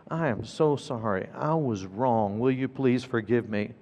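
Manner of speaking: low, soft, sincere apology; earnest apology. Retold or imitated. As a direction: apologize quietly and humbly. Father apologizing to crying son.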